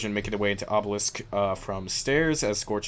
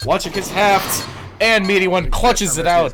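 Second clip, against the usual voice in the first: excited; 10 semitones higher, 10 dB louder than usual. Real, not acted. Clutch win call.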